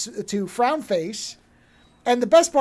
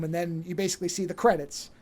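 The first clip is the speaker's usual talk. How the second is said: ordinary talk, nothing marked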